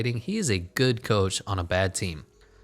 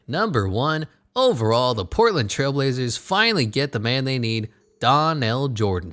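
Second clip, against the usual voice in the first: big, louder announcer voice; draft announcer; 4 semitones higher, 5 dB louder than usual. Acted. Game player announcing the first draft pick, big announcer voice. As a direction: announce the top pick with force.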